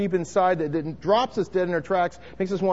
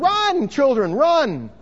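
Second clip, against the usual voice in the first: higher, louder retold mother's alarm; retold yell; 9 semitones higher, 6 dB louder, much slower than usual. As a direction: cry out to the kids to run. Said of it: "and so she yelled".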